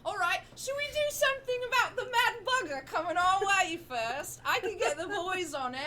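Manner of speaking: put-on high, loud scrappy street kid; cocky urchin voice. Street kid offers to set his boys on the goblin, high and loud. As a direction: brag it up high like a gang kid.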